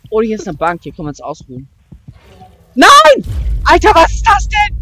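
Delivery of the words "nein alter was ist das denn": high, loud shocked yell; frightened shout. Real, not acted. Sudden monster scare. (erschrockener Schrei)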